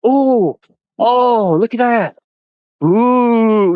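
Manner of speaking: very high, gasping ooh, look at that; squeaky high mock amazement; wide-eyed wonder. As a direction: play a dazzled tourist gawking at everything. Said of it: "I'd be like".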